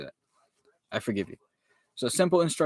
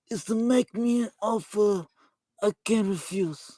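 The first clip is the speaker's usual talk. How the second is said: Vito Corleone; 3 semitones higher than usual. Acted. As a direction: put on an old movie godfather's voice. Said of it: "This is the Godfather from The Godfather".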